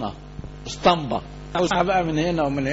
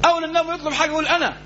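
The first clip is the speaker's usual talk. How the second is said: excited praise; 10 semitones higher, much faster than usual. Real, not acted. Preacher marvels at eager answer.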